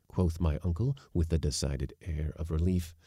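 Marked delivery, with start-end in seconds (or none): none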